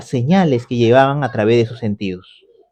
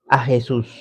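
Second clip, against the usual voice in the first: usual speaking voice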